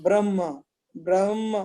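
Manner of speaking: ब्रह्म with h first, then m; "brah-ma", falling, for ब्रह्म; model pronunciation. Shown as a demonstration. Teacher repeats it for student, h-before-m pronunciation. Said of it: "पहले हा फिर मा".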